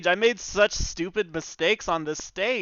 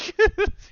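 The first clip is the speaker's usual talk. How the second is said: breathless laugh; 4 dB louder than usual. Real, not acted. Breathless after big fight.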